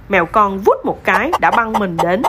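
ordinary delivery, nothing marked

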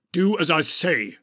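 loud, forceful order; fierce command. A narrator's reading. Wizard overrules companion who objects, fierce. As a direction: snap it out, allowing no argument. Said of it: "said Gandalf fiercely".

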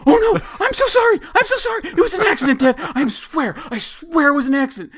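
put-on high, loud panicked kid, laughing through it; squeaky mock panic; panicked son. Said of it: "he instantly just freaked out".

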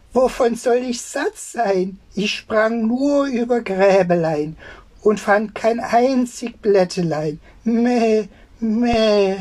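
high sing-song goat voice; bleating goat. Acted. Goat answering the old tailor in a high bleating voice. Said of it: "Die Ziege aber antwortete".

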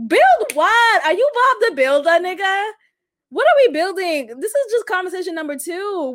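high, loud, disbelieving comeback; incredulous mock reply. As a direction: question him like he's being ridiculous. Mock reply to his text.

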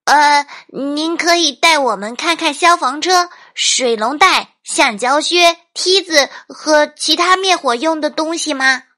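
put-on high, loud little boy asking permission; eager child. A narrator's reading. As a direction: ask like an excited kid listing gear. Narrator voices boy asking firefighter. (高亢响亮的小男孩请求声)

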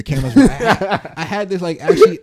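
laughing while talking. Real, not acted. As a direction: laugh through the words. Laughing mid-sentence at camera placement.

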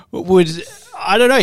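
laughing through the words. Real, not acted. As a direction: crack up while talking. Laughing mid sentence.

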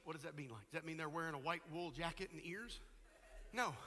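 ordinary delivery

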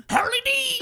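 shrill bird screech, very high and loud; squawking parrot imitation. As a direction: scream it like a cockatoo. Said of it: "he'd just scream".

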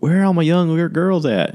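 high, loud crowd-work shout; mock emcee hype; comedian calling out. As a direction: rile up the young girls.

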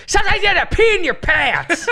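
mock yell. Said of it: "when he started yelling".

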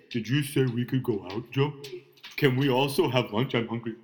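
lightly voiced hungry dog; the dog asking for lunch. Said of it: "that's why I do the dog voice".

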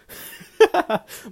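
high loud breathy laugh. Real, not acted. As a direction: shriek of a laugh. Laughs about hookups.

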